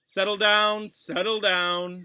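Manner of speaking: slow, drawn-out and bored; idle. Acted. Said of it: "said Snape idly".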